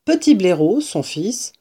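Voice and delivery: plain reading voice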